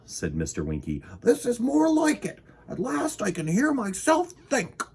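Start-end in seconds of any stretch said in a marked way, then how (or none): 1.2-4.8 high-pitched voice of satisfaction; pleased, high-voiced storybook character; high, relieved character. A narrator's reading.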